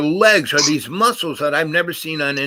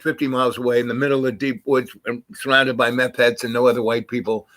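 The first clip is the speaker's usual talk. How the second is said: normal talking voice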